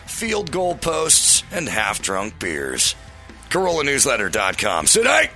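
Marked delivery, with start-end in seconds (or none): 4.9-5.3 announcer shout. Acted. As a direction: yell it like a hype announcer. Promo tag shout.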